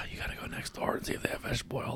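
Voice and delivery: fast, hushed whisper, urging; retold whispered urging. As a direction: whisper it quickly, pushing her to go. Asking wife to borrow oil.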